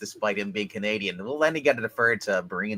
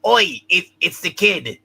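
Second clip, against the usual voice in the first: put-on Australian accent; 6 semitones higher, 7 dB louder, slower than usual. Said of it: "he said".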